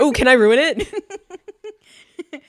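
high, slow mocking voice; mock Jon. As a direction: drawl it out teasingly.

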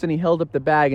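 ordinary delivery

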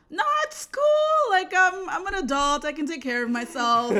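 acted-out panicked brush-off, loud throughout; frantic refusal. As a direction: fend him off loudly, starting in a squeal. Retells frantic refusal of bus escort.

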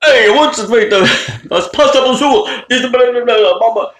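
mock Italian restaurant voice; Italian accent. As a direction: put on a jokey Italian accent while rattling off pasta. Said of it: "like, in Italian accent".

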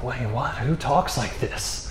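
very soft, stunned undertone; hushed disbelief. Retold or imitated. Listener reacts to Jesus' claim, hushed mock disbelief. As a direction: drop right down, as if quietly baffled.

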